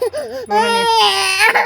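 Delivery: very high, slow, sing-song don't-cry; mother cooing a long, high plea to stop crying; drawn-out soothing. Real, not acted. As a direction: stretch it out to calm him. Comforting crying son.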